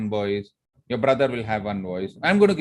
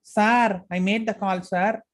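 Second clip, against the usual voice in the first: higher, eager secretary voice with a foreign lilt; Lily's accent; 8 semitones higher, slower than usual. Retold or imitated. Secretary Lily assuring her boss, in her own accent. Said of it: "how I changed my voice".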